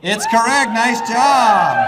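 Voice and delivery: big triumphant announcement to the room; game-show host shouting the win; excited shout. Real, not acted. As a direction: yell it out to the whole crowd. Announcing winner to crowd.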